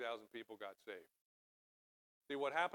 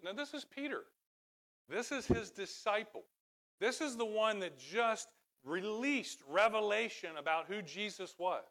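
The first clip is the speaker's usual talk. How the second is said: high-pitched pulpit emphasis, building louder; fervent preaching; 8 semitones higher, 6 dB louder than usual. Real, not acted. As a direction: press the point about the disciple on the congregation. Preaches at full force.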